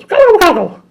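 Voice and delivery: high, loud turkey noise; chicken gobble. Retold or imitated. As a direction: gobble like a cartoon bird. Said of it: "And it goes".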